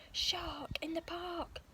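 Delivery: high, slow whispered shout; boy's alarm call kept to a whisper; whispered mock shout. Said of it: "at the top of his voice".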